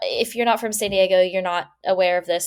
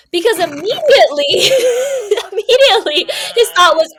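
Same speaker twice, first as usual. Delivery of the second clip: laughing; 11 semitones higher, 9 dB louder than usual. Real, not acted. Laughing at the absurd story.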